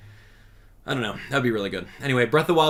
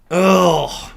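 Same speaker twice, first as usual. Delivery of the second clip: loud, raised groan; exasperated groan; 6 semitones higher, 10 dB louder than usual. Real, not acted. Streamer recalling a terrible game, loud exasperated groan. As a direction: groan "ugh" loudly in frustration.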